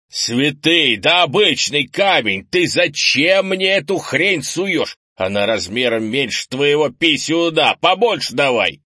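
loud, high, angry tirade; exasperated shouting. A narrator's reading. Grandfather berates grandson over the wrong stone, exasperated shouting. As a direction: scold at full voice.

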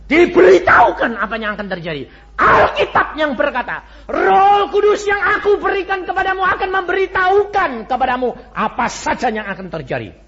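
loud, high testimony cry; preaching at full force. Acted. Preacher proclaiming his own practice to the congregation, full force.

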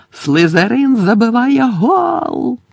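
slow, high, drawn-out announcement; commentator's goal call. Acted. Commentator announces a Slytherin goal above the jeers, calling out. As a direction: stretch the goal call over the crowd. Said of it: "почувся Джорданів голос серед вигуків та улюлюкання".

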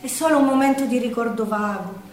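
ordinary talk, nothing marked

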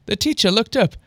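ordinary delivery, nothing marked

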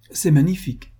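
a light touch of mock amazement; incredulous. A narrator's reading. Uncle Jean to Moret, mildly incredulous. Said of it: "reprit l'oncle Jean incrédule".

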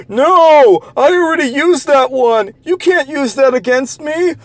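exaggerated squealing mimicry of opponents; mock whiny protest. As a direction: pitch it way up like an indignant complainer. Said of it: "you guys go like".

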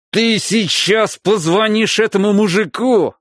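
high, slow furious yell; furious shouting. Acted. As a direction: threaten him at full voice. Father threatening son violently.

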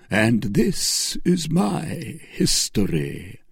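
solemn executioner; executioner's voice. A narrator's reading. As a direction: reveal yourself with icy calm. Executioner reveals himself.

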